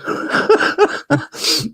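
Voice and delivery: high breathy burst of real laughter; big laugh. Real, not acted. To the friend after the old man's quip, laughing hard. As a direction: crack up at the punchline.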